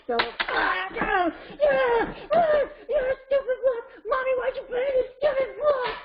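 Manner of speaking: high-pitched, wailing puppet tantrum; child tantrum. Pretend. Child furious at fallen blocks, high screeching mock tantrum. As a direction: scream and wail like a furious child. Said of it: "Here's what it might look like in your house".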